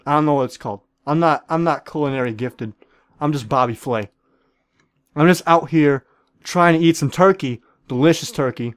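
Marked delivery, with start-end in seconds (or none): none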